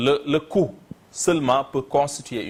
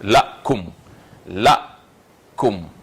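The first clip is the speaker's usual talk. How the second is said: la and koum as two separate syllables; 4 dB louder, much slower than usual. Shown as a demonstration. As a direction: stronger la, then a falling koum. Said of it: "le mot est ici constitué de deux syllabes".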